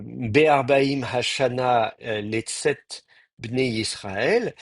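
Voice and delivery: Hebrew words read out slowly, syllable by syllable; "Be'arbaim Hashana, Letzet Bnei Israël" spelled out slowly for the class. Shown as a demonstration. Teacher reading the Hebrew verse slowly to students. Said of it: "donc la quarantième année".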